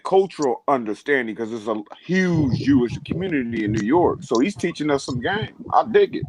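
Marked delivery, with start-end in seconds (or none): 5.7-6.2 high, delighted exclaim; excited. Real, not acted. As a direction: squeal it out happily. Excited over new knowledge.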